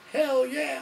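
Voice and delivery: high, loud hammed-up "hell yeah"; eager yell. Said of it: "you guys like wrestling?".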